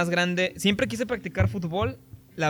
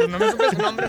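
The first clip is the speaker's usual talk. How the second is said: much higher, laughing out the words; 4 dB louder than usual. Real, not acted. Laughing at his own story with friends, much higher. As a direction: giggle the line high.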